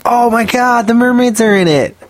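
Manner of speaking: excited gushing. Retold or imitated. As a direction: gush like a thrilled fan. Mimicking hyped-up fans.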